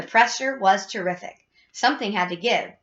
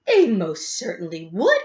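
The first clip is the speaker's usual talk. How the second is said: slow, drawn-out affronted insistence; indignant cry. A narrator's reading. Missus Gloop defends her son's flavor, indignant. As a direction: stretch it out, deeply offended. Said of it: "cried Missus Gloop indignantly".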